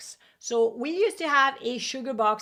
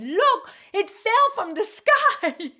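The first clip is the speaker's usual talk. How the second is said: high, slow, loud and thrilled; excited child; 11 semitones higher, 7 dB louder, slower than usual. Retold or imitated. A child excitedly showing the found bill to mom. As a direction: show off the bill with childish glee.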